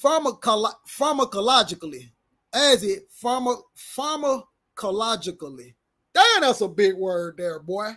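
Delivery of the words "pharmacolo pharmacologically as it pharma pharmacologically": sounding out. Real, not acted. Sounding out a long word.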